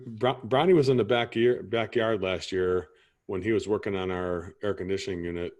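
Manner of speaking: ordinary talk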